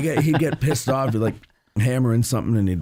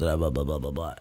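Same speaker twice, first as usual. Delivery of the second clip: low, soft croak of a grandfather just woken; grandfather's morning voice; 7 semitones lower, 6 dB quieter than usual. Retold or imitated. Grandson imitating his grandfather's croaky morning voice. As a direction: croak it out low and quiet like an old man just up. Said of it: "he'd sound like this".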